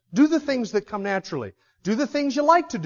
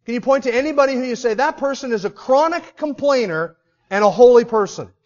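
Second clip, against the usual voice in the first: building preaching; 5 semitones higher, 6 dB louder than usual. Acted. Preaching rhetorical challenge.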